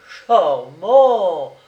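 loud sarcastic cry of charmant; exclaiming. A narrator's reading. Commandant mocking the prisoner, loud sarcastic cry. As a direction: fling out the word mockingly. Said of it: "s'écria le commandant".

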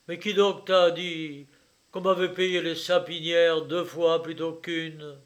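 loud, insistent demand; angry questioning. A narrator's reading. Father demanding who told his son, angry questioning. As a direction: demand the source, forcefully.

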